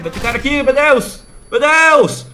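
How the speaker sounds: rising loud panic; panicked shout. Real, not acted. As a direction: yell in alarm as enemies close in. Enemy coming, panic. (grito de pânico)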